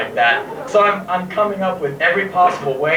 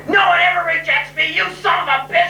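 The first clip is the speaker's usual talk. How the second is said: much higher, yelled insult; furious shout; 12 semitones higher than usual. Retold or imitated. Rejected girl yelling at him, furious. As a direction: scream at him in outrage. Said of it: "she goes like".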